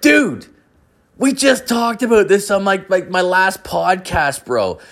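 high, drawn-out incredulous retort; retold high-pitched indignant protest; exasperated protest. Storyteller replays his protest at a friend's question, exasperated.

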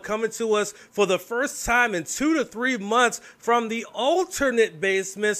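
upbeat show-host opening; announcer. Acted. Host's opening announcer read.

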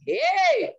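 sudden high, loud exclamation of shock; a startled cry as the thought hits; shocked hey. Retold or imitated. A wife telling the congregation, startled outcry. Reacting to her own thoughts.

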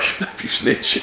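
hissed whisper insult. Retold or imitated. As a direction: whisper it harshly. Repeating the quote.

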